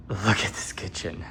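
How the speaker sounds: hushed, breathless whisper of awe; awed whisper. Real, not acted. Showing viewers the kitchen, awed whisper. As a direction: whisper it like you can't believe the kitchen.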